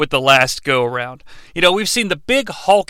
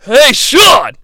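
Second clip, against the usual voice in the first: loud call across the room; 7 semitones higher, 8 dB louder than usual. Acted. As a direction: shout his name to get attention. Calling out to Sean.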